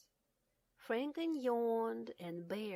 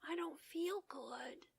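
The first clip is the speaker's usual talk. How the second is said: soft, slow, worried whisper; 8 semitones higher, 7 dB quieter than usual. Acted. Homesick Bear confiding to Franklin at night, whispering. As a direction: whisper it like a sad secret. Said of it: "he whispered".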